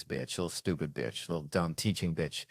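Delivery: under the breath at the teacher; muttered insults. Retold or imitated.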